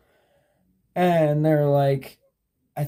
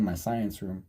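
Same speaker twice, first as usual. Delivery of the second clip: usual speaking voice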